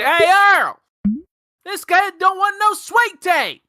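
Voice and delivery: hammed-up Southern waitress calling to the kitchen. Waitress voiced in retold story.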